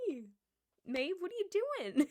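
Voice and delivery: high, loud amused singsong; playful questioning. Retold or imitated. She finds coworker with bread, retold playful questioning. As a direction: call out to her, puzzled and playful.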